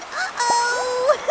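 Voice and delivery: high, loud alarmed uh-oh then a laugh; startled uh-oh into giggles; surprised uh-oh. Real, not acted. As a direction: yelp at the mishap and laugh. Game mishap, exhausted.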